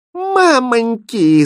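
high, loud, drawn-out wail; acted scared village girls' cry; frightened cry. A narrator's reading. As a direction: shriek it in alarm, stretching the word. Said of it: "закричали девушки".

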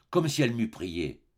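ordinary delivery

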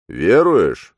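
loud, rising priestly question; a booming do you believe; priest's question. A narrator's reading. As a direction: demand an answer of faith. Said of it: "спросил он".

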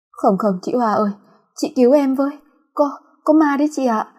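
high frightened pleading; trembling plea. Acted. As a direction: beg in fear of the ghost. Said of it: "run rẩy, từ giọng nói". (run rẩy van xin)

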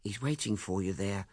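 lightly voiced fussy mother; a hint of a flustered older woman; Mrs Chaffrey's voice. A narrator's reading.

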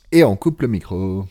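ordinary delivery

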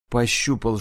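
ordinary delivery

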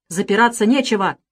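agitated, rising accusation; hollow, breaking shout. A narrator's reading. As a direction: shout down the denial. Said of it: "крикнул глухим, срывающимся голосом".

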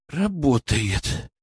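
slow, relieved sigh of a word; exhaled with relief. A narrator's reading. Colleague sees blood pressure rising, breathing out relieved. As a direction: let the tension out gently. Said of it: "с облегчением выдохнул Петров".

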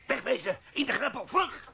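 rising to a high yell on vlug; urgent shout. Acted. Sergeant yelling at his men to take cover. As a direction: bark the order to take cover, fast. Ticking bomb, ordering men away.

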